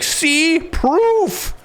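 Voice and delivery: very high, hammed-up exclamations; mock triumph. Mocking an accuser showing his evidence, mock triumph. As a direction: crow each word in a high mocking voice.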